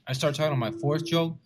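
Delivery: ordinary talk, nothing marked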